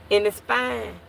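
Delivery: normal talking voice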